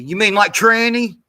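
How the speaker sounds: loud jeering imitation; mock quote. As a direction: throw her question back with a sneer. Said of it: "Nancy Mace was the one who".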